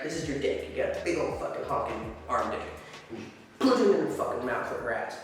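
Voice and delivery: usual speaking voice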